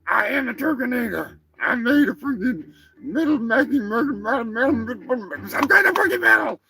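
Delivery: high-pitched, stumbling boast; boastful Turkinator turkey. Acted. Turkey corrects the host on what it is, high and boastful. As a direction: brag high as a metal turkey monster.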